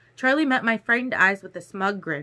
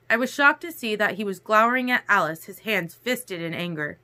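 ordinary delivery

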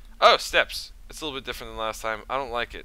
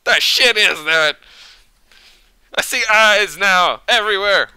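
high loud panicked yell; panicked self at night; 10 semitones higher, 12 dB louder than usual. Retold or imitated. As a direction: shout like you're terrified in bed. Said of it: "staring up at the ceiling, like".

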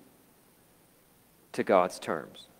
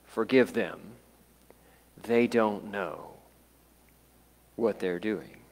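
soft, slow plea fading lower; quiet prayer; 3 semitones lower, 7 dB quieter than usual. Retold or imitated. Jesus on the cross praying for those responsible, quiet prayer. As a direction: pray it softly, with long pauses. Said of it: "He prayed".

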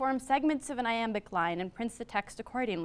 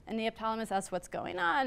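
ordinary talk

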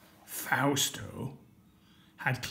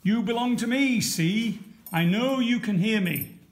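higher and louder, insisting; still louder; 8 semitones higher, 5 dB louder than usual. A narrator's reading. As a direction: shout it even harder at the silent sea. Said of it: "still louder".

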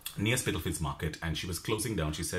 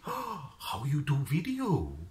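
put-on slow, lightly accented broken English; pub friend's accent; 5 semitones higher, slower than usual. Pub friend Kirill asking about the nose, slow light accent. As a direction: ask it slowly in a light foreign accent. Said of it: "Kirill, he said to me".

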